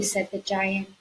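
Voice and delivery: ordinary delivery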